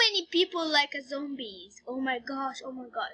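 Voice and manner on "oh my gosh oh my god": low, soft aside of dread; hushed dread. A narrator's reading. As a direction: drop low and hush it. Narrator reacts to zombies.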